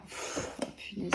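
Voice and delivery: ordinary talk, nothing marked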